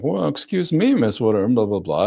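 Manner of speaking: high, mock-offended teasing; jokingly mock-offended reply to the date; joking mock offense. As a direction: tease her with fake indignation. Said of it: "jokingly go along with that".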